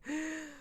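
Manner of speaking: sharp shaky inbreath of crying; sobbing gasp. Retold or imitated. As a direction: show the deep crying gasp. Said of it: "the deep breath".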